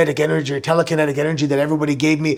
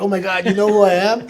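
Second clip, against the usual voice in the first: laughing, higher disbelief; starstruck surprise; 5 semitones higher than usual. Retold or imitated. Wrestler meeting Shaquille O'Neal, laughing in starstruck disbelief. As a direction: gush like a starstruck fan. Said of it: "And I'm like".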